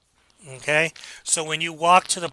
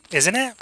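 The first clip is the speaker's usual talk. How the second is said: "isn't it" lifted higher as a question; tag question; 5 semitones higher than usual. Shown as a demonstration. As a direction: tack on "isn't it" with questioning, raised pitch. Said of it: "You put that question at the end".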